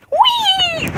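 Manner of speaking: high squeal of delight; gleeful squeal. Real, not acted. Playing with dog.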